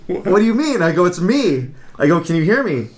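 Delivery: baffled. Retold or imitated. Retold confused phone call.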